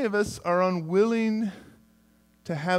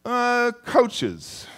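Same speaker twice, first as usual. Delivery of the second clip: ordinary talk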